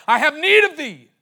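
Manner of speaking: high, loud cry. Acted. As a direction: cry the Lord's words out. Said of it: "crying out".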